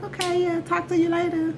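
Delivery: high, even, put-on sweet goodbye; polite brush-off. Girl seeing off the fidgety guy, high-pitched brush-off. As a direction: send him off in a high little voice.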